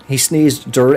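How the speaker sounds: ordinary talk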